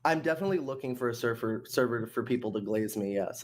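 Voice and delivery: lightly put-on sarcastic tone. Real, not acted. Answering the accusation of looking for glazers, mildly sarcastic. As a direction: feign sincerity with a touch of sarcasm. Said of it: "doing the voice right now that insinuates that you're speaking sarcastically".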